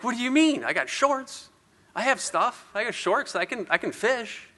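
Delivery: high-pitched, stunned self-defense; stunned protest. Retold or imitated. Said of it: "like, stunned".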